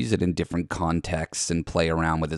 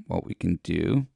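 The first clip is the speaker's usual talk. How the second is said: normal talking voice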